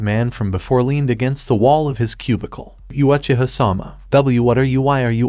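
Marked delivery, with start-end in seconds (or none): none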